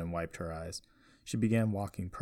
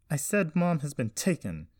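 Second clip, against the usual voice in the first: high, loud angry insistence; 8 semitones higher, 5 dB louder than usual. A narrator's reading. Jessie repeating herself to her grandfather, angry. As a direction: snap it back, fed up. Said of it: "knowing full well how angry she sounded".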